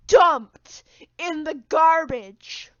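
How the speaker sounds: loud, very slow dramatic wail. Acted. Telling how Harold's things get trashed, drawn out loud. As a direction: stretch it out like a tragedy.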